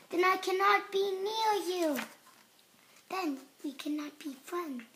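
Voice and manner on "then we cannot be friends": ordinary delivery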